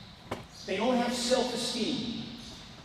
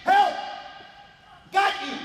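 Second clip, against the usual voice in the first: court calls; 7 semitones higher, slower than usual. Shown as a demonstration. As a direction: bark the calls to teammates on the floor. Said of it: "short and simple and one syllable".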